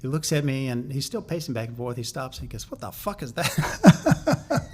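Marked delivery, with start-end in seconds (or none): 2.7-4.7 mock-incredulous, higher and slow through a laugh; laughing disbelief. Manager scoffing at the retreat idea, slow laughing disbelief. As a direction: scoff at it like it's the silliest idea yet.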